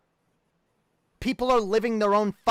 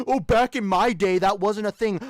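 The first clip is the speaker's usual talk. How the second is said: mock old-timer; 2 semitones higher, 4 dB louder than usual. Mocking an old-timer's excuse.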